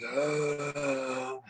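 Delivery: long, low droning zombie moan; drawn-out imitation of a zombie's moan; zombie groan. Storyteller showing the weird sound his friend made, zombie groan. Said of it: "it was a weird zombie thing".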